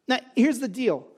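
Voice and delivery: ordinary talk, nothing marked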